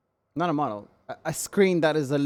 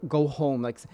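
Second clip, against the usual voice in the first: ordinary talk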